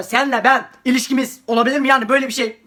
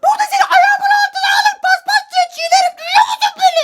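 put-on much higher, shrill-sounding mean girl; bully girl voice. Bully girl snaps at the girl in the toilet.

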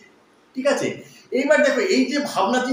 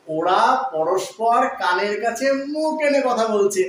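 slow repetition; slower than usual. Shown as a demonstration. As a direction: repeat the sentence slowly, word by word. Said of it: "কি বললাম?". (ধীরে ধীরে আবার বলা)

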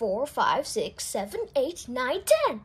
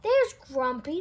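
plain reading voice

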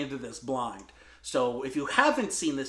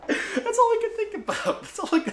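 laughing. Real, not acted. Laughing after the aunt impression.

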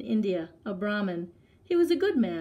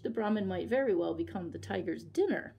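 usual speaking voice

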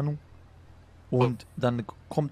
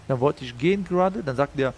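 ordinary delivery

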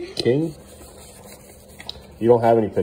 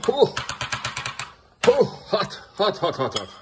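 hot hot hot; 11 semitones higher than usual. Real, not acted. Burning hand on hot pancakes.

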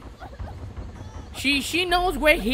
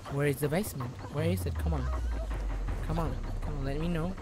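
hushed muttering; 8 semitones lower, 11 dB quieter, faster than usual. Real, not acted. Listening carefully for the hatch.

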